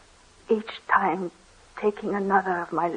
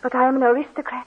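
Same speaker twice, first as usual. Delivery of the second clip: higher, faster, pressing the point; urgent insistence; 5 semitones higher, 4 dB louder, faster than usual. Acted. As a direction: blurt it out anxiously.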